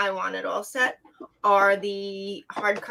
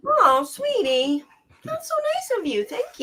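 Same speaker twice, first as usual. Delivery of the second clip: touched and sweet; 13 semitones higher than usual. Real, not acted. Child offers a handmade craft.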